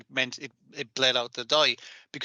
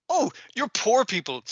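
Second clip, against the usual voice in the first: high-pitched, put-on snooty pity; mock condescension; 7 semitones higher than usual. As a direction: look down on the poor passengers. Acted snooty first-class passengers in retelling.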